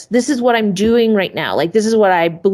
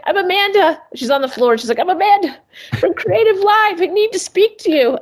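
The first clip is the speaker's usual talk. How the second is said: much higher eager voice throughout; eager recruiter; 11 semitones higher than usual. Retold or imitated. Recruiter sprawled on the floor introducing herself, high eager voice. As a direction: pitch it way up, eager and breathless.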